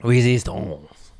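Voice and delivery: slow "Resistance." as "ree-zis-tonz"; Louisiana pronunciation. Shown as a demonstration. Showing how Louisiana says it, slow "ree-zis-tonz". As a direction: stretch "Resistance." out Louisiana-style. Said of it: "That's how you say it. It's from Louisiana".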